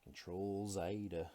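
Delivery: loud, drawn-out put-on foreign accent on "Control Zeta"; mock foreign accent. Shown as a demonstration. Artist jokes about the undo key in a put-on foreign accent. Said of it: "That accent".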